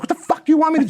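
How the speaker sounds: high, strained mock exasperation; exasperated retort. Struggling passenger answering his helper, high exasperated retort.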